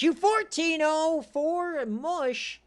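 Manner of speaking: high, loud and slow, stretched out; dragged-out high shout of disbelief; excited yell. Real, not acted. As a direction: call the score like a fan losing it. Game sim score call.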